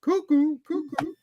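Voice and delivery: high, loud cartoon voice, far from his own; cartoon character voice. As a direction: play a wacky cartoon character. Acting out show character lines.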